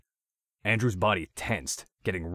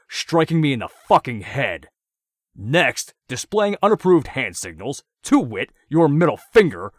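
climbing louder and higher, furious charge sheet; enraged; 7 semitones higher, 12 dB louder than usual. A narrator's reading. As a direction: read the charges with mounting rage. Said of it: "seemed to enrage Andrews".

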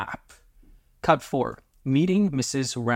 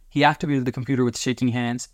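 plain reading voice

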